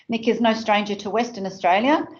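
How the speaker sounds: usual speaking voice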